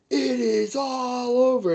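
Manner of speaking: high, slow announcer impression; UFC commentator's catchphrase. Imitating a commentator people got tired of, high stretched call. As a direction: draw out the fight-ending call. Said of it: "he said".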